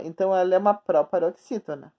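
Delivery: usual speaking voice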